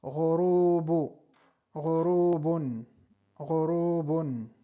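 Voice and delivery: ghurubun slow, gh rasped; vocabulary drill. Shown as a demonstration. Teacher repeats ghurubun for learners, slow and clear. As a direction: say ghurubun carefully. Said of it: "Y a puesta del sol".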